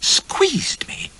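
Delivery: high scandalised whisper; shocked whisper. A narrator's reading. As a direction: whisper it appalled. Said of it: "as though I had uttered some unmentionable word".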